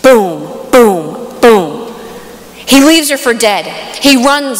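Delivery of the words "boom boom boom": low, punchy boom, boom, boom; mock stab sounds. Retelling the stabbing, low thudding booms. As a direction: thump out each blow. Imitating the knife blows.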